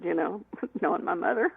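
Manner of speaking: usual speaking voice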